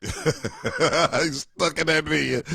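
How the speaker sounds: high laugh breaking into the words. Real, not acted. Comic laughing at the parent stuck in bed, laughing. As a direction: crack up while talking. Cracks up at own screaming bit.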